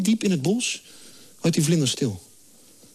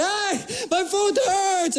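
high, drawn-out wail for help; comic cry of pain; hammed-up pained call; 12 semitones higher, much slower than usual. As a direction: call up to someone in mock agony. Retold cry to the lifeguard.

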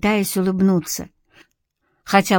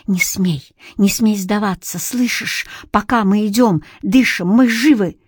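ordinary delivery, nothing marked

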